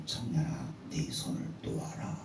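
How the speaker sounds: low, quiet divine command; voice of God. Acted. God tells the clinging young man to let go, low and quiet. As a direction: speak softly from above, unhurried. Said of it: "마지막 말씀하고 있습니다".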